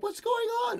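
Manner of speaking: high, small baffled squeak; mock bewilderment. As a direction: act out confusion in a soft high voice. Acts out his own confusion.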